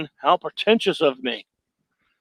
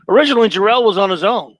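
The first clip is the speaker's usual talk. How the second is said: ordinary talk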